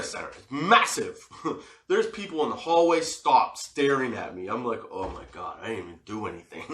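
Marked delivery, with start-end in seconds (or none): 4.9-6.6 low, soft, quick mock protest; small embarrassed aside, dropped in pitch; meek protest. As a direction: shrink under the stares, protesting innocence. Berated guard protesting to onlookers.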